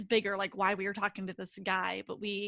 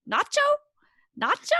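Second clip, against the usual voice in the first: loud, high call for a lost cat; retold owner calling his cat; 10 dB louder than usual. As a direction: call his name out across the site.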